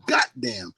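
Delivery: "goddamn" with T replacing the first D; GOT with a T. Shown as a demonstration. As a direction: say "goddamn" as "got-damn", T stopped hard. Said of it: "G O T".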